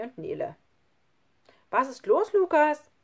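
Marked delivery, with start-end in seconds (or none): 1.7-2.9 louder, higher, breezy question; carefree call. A narrator's reading. Schlunz enters Lukas's room, carefree call. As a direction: call to him without any guilt. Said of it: "rief Schlunz und schien überhaupt kein schlechtes Gewissen".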